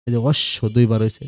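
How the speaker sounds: ordinary talk, nothing marked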